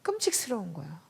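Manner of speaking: near-whisper, very soft; real whispered aside to oneself; hushed dread. Real, not acted. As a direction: let it out under your breath, appalled. Dread at her own possible failing.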